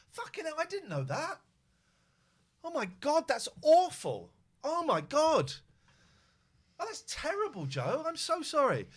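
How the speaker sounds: high-pitched stunned reaction; shocked. Real, not acted. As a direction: react in real shock and sympathy.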